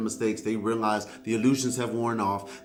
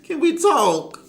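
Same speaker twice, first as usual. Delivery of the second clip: begging ex; 9 semitones higher, 6 dB louder, slower than usual. Acted. Voicing desperate ex begging.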